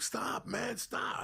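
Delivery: high, whiny mock cry to stop; mock pleading. Friend begging the biting dog to stop, mock pleading. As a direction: squeal like a friend getting bitten. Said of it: "And Stinky would".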